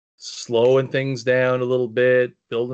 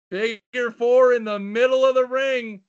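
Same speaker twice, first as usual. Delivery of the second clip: excited ringside call, higher pitch; wrestling commentator; 8 semitones higher than usual. Real, not acted. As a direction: hype up the hold like play-by-play. Calling the figure four hold.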